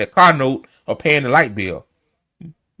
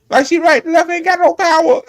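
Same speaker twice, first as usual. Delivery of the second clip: excited; 12 semitones higher than usual. Real, not acted. Repeating point, rising excitement.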